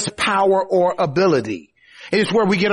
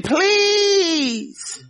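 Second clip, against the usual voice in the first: high wailing, stretched-out plea; acted shrill tantrum cry; screaming child; 12 semitones higher than usual. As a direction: beg like a child throwing a fit.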